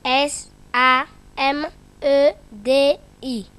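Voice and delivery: name each letter apart like a pupil; spelling letter by letter. Shown as a demonstration. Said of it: "Lis ce mot en, en lettres". (épeler lettre par lettre)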